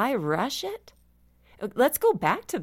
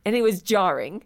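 usual speaking voice

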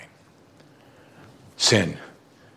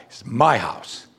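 ordinary talk

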